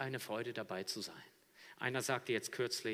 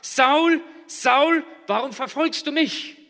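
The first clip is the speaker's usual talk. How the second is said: loud, much higher, ringing call; voice of Jesus calling; 20 dB louder, slower than usual. Acted. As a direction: call Saul down from heaven. Jesus calls out to Saul. (laut rufende Stimme Jesu)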